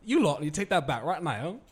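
hammed-up English accent, shouted; mock English accent. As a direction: scold the crowd in a put-on English accent. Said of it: "but in English accent".

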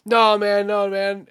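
high, loud protest; loud mock pleading. Comedian refusing the heckler, loud mock plea. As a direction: plead loudly, hammed up. Comedian begs in retold bit.

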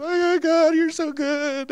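high, drawn-out imitation wail; mock crying. Man on the floor thanking God, hammed-up crying. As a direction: wail it out as if overcome with tears. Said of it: "cried, shook for, like, thirty minutes".

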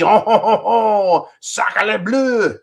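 hammed-up Frenchman's chortle, high and loud; mock French accent. Host showing off his French, exaggerated French accent. Puts on stereotype French exclamations.

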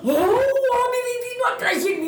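very high squealing wail, laughing; reenacting a starstruck scream; excited shriek. Runner reliving the prince walking towards her, excited shriek.

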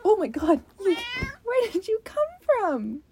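high-pitched pet-talk surprise; delighted surprise. Real, not acted. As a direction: coo in surprise at the cat. Cooing at cat that turns up.

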